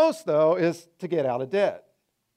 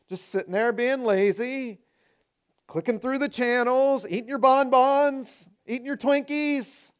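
mocking; 6 semitones higher than usual. Mocking the lazy TV watcher.

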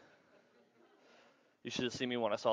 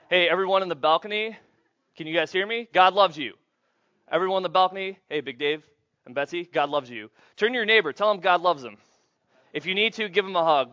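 louder, higher call to the back rows; 5 semitones higher, 9 dB louder, faster than usual. Real, not acted. Preacher calling to the balcony crowd, loud and high. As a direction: project it up to the balcony.

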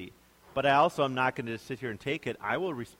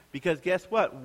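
ordinary delivery

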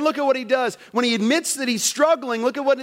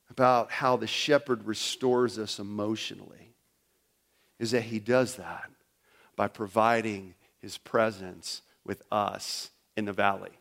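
soft reflective close; 11 semitones lower, 8 dB quieter than usual. Real, not acted. Sermon turns tender and reflective.

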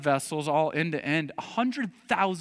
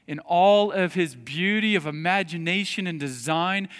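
usual speaking voice

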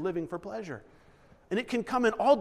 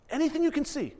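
high emphatic repeat; 9 semitones higher, faster than usual. Shown as a demonstration.

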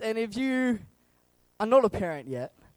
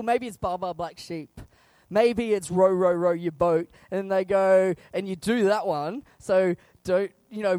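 ordinary talk, nothing marked

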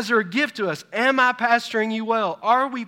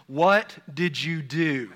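slow, lightly voiced questioning mother; a hint of mom interrogating her son; suspicious mom; 3 semitones lower, slower than usual. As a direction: imitate mom, drawing out each word.

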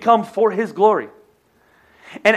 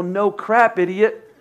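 ordinary talk, nothing marked

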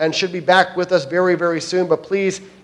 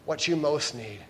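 soft, tender, hushed; a lightly voiced, quiet Jesus; gentle voice of Jesus; 9 dB quieter than usual. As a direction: speak as Jesus offering himself, softly. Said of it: "And Jesus says".

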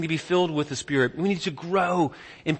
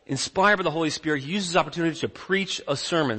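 ordinary talk

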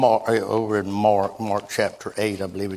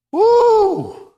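joyful whoop; 8 dB louder than usual. Real, not acted. Preacher exclaims in excitement.

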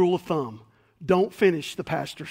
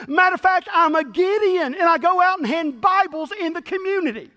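higher, louder proud church man listing his deeds; proud boasting; 8 semitones higher, 5 dB louder than usual. Acted. Proud churchgoer boasting to the angel at heaven's gate. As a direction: puff up and brag about every good deed. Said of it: "And he said".

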